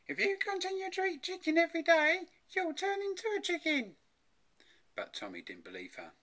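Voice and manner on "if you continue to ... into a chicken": high, loud mother's warning; scolding mother. A narrator's reading. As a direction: warn the child in a high-pitched mum voice. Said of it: "His mother said to him".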